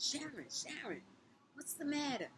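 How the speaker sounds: a high, lightly voiced worried teacher; alarmed teacher. Teacher rushing in to Sharon, high alarmed call.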